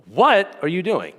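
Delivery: What stressed long and high, then falling. Shown as a demonstration. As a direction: lean hard and slowly on the word What. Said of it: "inflect different parts of that sentence".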